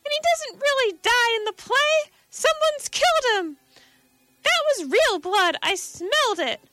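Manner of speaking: a woman falling apart in panic; hysterical woman. Acted. Woman in the crowd begs to escape, hysterical. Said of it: "a woman was sobbing hysterically".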